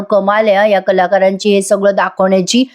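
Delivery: ordinary delivery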